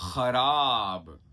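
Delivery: खराब drawn out very slowly with a throaty ख; slow nukta kh. Shown as a demonstration. Teacher slowly demonstrating खराब with nukta. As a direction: stretch खराब, rasping the ख. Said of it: "अगला शब्द है".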